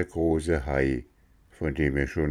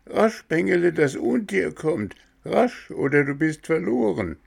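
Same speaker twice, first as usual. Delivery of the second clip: urgent goat; 12 semitones higher than usual. Acted. Goat urges Bengele to swim.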